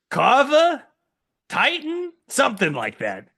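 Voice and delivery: goblin Jerry. Acted. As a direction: insist like a simple goblin. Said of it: "what is it you're saying".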